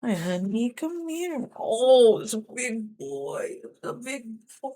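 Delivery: tiny, high voice for fussing over a pet. Real, not acted. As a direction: coo little endearments to a pet. Cuddles and coos at pet.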